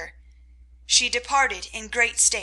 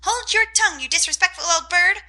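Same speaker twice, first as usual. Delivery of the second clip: very high, loud parrot copy of the scold; parrot mimicking; 8 semitones higher, 5 dB louder than usual. A narrator's reading. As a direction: squawk the words back like a mocking bird. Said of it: "cried Polly".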